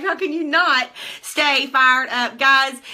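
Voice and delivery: normal talking voice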